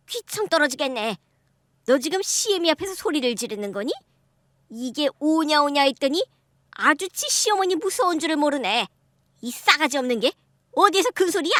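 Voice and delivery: lightly voiced haughty mother-in-law scolding; a touch of a domineering elder putting her in place.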